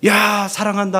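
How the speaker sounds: the wrong way, a blaring "야, 사랑한다"; loud declaration. Shown as a demonstration. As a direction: parent blurting love over a sleeping baby, too loud. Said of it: "하면서 그렇지 않죠".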